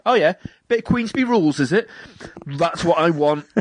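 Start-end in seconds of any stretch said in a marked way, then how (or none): 0.1-1.9 mock gentleman boxer, pitched up; high jokey old-fashioned fighter; old-school bruiser. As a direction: square up like a Victorian gent. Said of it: "be like".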